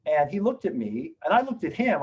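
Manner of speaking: usual speaking voice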